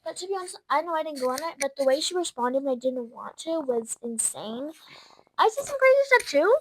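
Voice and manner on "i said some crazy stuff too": excited burst. Real, not acted. Excited retelling, pitch jump.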